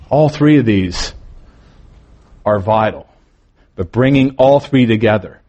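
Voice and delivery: usual speaking voice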